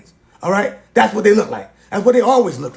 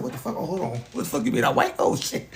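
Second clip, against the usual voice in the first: stunned hush; 7 semitones lower, 9 dB quieter than usual. Retold or imitated. Retells stunned reaction to doctor.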